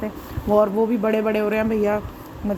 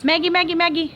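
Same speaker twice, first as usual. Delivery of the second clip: calling a pet by name, pitched up; calling the dog; 7 semitones higher than usual. Real, not acted. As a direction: coax the dog to come, high and bright.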